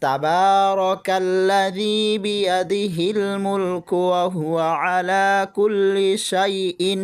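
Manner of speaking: slow, drawn-out chanted recitation of the verse; slow Quran recitation. Shown as a demonstration. Teacher reciting the verse for students, slow and chanted. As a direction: recite it slowly, drawing out each vowel. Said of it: "একসাথে মিলিয়ে পড়ি শুরু থেকে".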